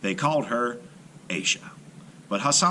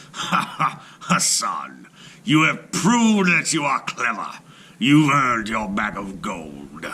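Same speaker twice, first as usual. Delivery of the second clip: grudging, louder, laughing king; laughing Sultan; 3 dB louder, slower than usual. A narrator's reading. The Sultan conceding to Hassan, grudging laughing ruler. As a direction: laugh it off despite yourself. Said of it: "he had to laugh too".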